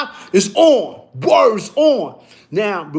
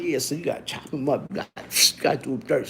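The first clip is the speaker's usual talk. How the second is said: low urging; 6 semitones lower, 10 dB quieter, faster than usual. Retold or imitated. Quietly urging friend to fight.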